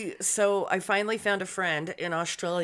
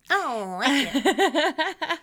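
slow, laughing "I don't like it"; laughing repeat; 7 semitones higher, 5 dB louder, much slower than usual. Real, not acted. Host repeating her refusal of cocaine while laughing. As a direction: giggle your way through it.

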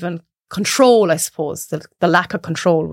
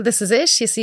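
ordinary talk

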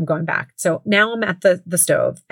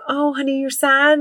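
put-on higher, tender coo to a sad child; comforting honey; 8 semitones higher than usual. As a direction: soothe him like a sad little boy. Mom comforting son, quoted.

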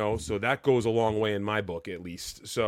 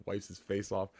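ordinary delivery

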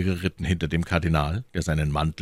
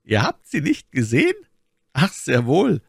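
animated reply, higher voice; lively; 10 semitones higher, 4 dB louder than usual. A narrator's reading. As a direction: respond with quick interest. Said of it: "versetzte der Kardinal lebhaft".

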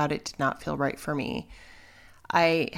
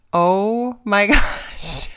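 slow, stretched out through a laugh; laughing amazement; 4 semitones higher, 9 dB louder, much slower than usual. Real, not acted. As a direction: still stunned, laughing at it. Recalling the positive test.

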